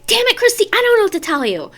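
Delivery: high, loud, fed-up mother snapping; exasperated mom. Retold or imitated. Mom answering crying daughter while smoking, loud and exasperated. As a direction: imitate a frustrated mom at her wits' end. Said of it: "that's literally how she was".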